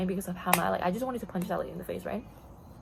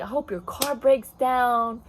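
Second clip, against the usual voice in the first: louder, slow and drawn out; retold spiteful curse; 3 semitones higher, 6 dB louder, much slower than usual. Customer cursing the store worker, imitated spiteful drawl. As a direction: draw the curse out loudly, imitating a bitter customer.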